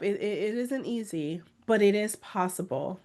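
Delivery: ordinary delivery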